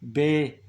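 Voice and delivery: बे said loud and clear as bay, a long e after b; word said out clearly. Shown as a demonstration. Teacher sounding out बे loudly for students. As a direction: stretch बे so the vowel after b rings out. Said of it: "देखते हैं कि vowel की आवाज़ कहाँ पे आ रही है".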